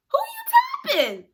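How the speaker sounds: very high, squeaky challenge; mock indignant squeal. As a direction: squeal it in comic outrage. Indignant reaction to being tapped.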